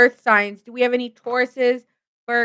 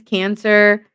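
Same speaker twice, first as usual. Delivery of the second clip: ordinary talk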